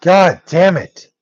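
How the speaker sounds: "God damn it" stretched slow at normal volume; slow drawn-out curse. Real, not acted. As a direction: drag out "God damn it" slowly, each word heavy. Swearing again, drawn out.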